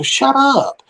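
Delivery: high-pitched playful protest; mock indignant retort. Answering people who call the accent cute, mock indignant. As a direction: swoop up high in mock offense.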